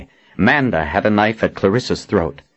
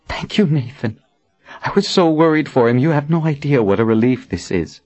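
higher voice, gushing thanks; grateful Verna, relieved; 7 semitones higher than usual. A narrator's reading. Verna thanks Nathan for healing Warren, deeply relieved. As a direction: pour out thanks in a higher register.

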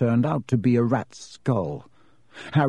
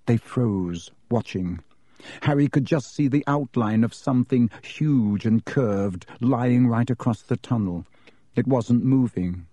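ordinary delivery

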